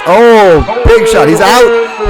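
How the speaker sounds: loud knockout call; excited commentary. Real, not acted.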